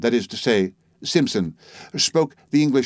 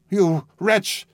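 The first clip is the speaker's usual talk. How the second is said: higher, gasping insult; breathless outburst; 8 semitones higher than usual. Acted. Narrator gasping at the old woman in outrage. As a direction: spit it out while catching your breath. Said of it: "said I, catching my breath".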